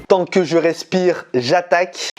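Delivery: ordinary talk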